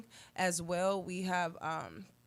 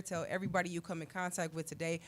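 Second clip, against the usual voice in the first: usual speaking voice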